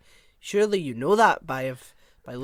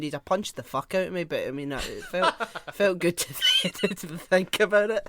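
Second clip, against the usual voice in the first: normal talking voice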